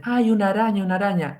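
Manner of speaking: high-pitched mock cry of alarm; retold spider scream. Two coworkers startled by a spider at the crime scene, retold high-pitched mock scream. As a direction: imitate coworkers yelping in panic, without full volume. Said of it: "empezaron a gritar".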